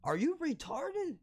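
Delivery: imitating an irked friend, pitch jumping high on the question; aggravated disbelief. Friend correcting him about the storage place, aggravated. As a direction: act out exasperation, starting high and easing down. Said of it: "kinda aggravated".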